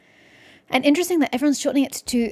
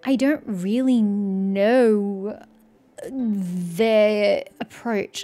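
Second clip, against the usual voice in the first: drawn-out hesitation; much slower than usual. Real, not acted. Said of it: "I'm only seeing one side".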